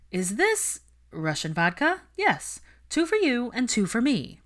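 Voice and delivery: a high, loud, eager yes; eager friend. A narrator's reading. Pansy handing out vodka shots to Hermione, excited. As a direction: jump in high and loud with the answer.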